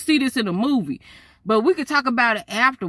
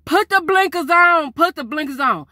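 louder, higher panicked plea; 8 semitones higher, 5 dB louder than usual. Retold or imitated. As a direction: beg him, frantic, to hit the blinkers. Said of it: "I said".

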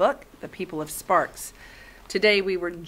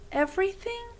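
surprised question; 12 semitones higher, 4 dB quieter than usual. Acted.

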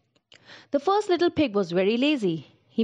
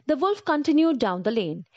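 ordinary delivery

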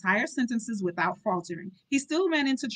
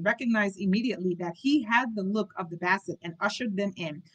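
ordinary delivery, nothing marked

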